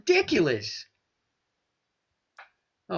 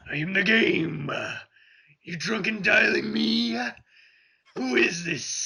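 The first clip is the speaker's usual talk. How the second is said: pirate voice. Retold or imitated. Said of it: "Now you sound like a pirate".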